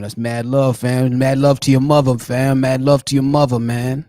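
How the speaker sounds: playful New York slang; New York cousin talk. Retold or imitated. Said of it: "That's how they talk".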